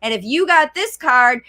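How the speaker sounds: normal talking voice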